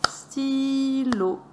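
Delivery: "stylo" chanted slowly, syllable by syllable; slow, held-out "stylo" in two beats; scanned syllables. Shown as a demonstration. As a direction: stretch the first syllable of "stylo" long and high. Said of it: "on va scander les syllabes".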